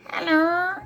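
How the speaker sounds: chirpy puppet hello, pitched a little up; small puppet character saying hello. Acted. Puppet Ernie greets children.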